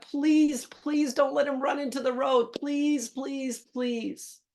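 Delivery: retold desperate prayer. Exhausted owner praying dog avoids the road, retold desperate pleading. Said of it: "praying to God".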